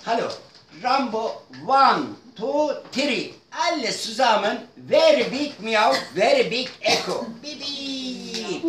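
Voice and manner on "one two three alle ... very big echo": usual speaking voice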